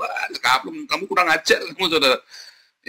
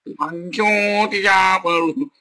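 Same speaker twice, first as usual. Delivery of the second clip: a hint of a sped-up chipmunk singer, slow and higher; 6 semitones higher, slower than usual. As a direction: sing it like a chipmunk track. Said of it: "Pakai suaranya Cipmong gitu kan".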